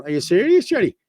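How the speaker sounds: are-you-kidding-me shock; incredulous surprise. Real, not acted. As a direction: pitch shoots up in disbelief. Reacting to alarming comment.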